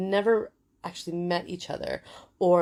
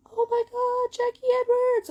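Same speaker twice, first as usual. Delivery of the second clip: high-pitched delighted shriek, much louder; excited screaming fans; 7 dB louder than usual. Retold or imitated. Fans in a Denver lobby spotting Jackie, shrieking with excitement. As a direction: scream her name like a starstruck fan. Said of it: "these women started screaming".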